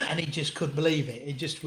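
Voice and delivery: ordinary delivery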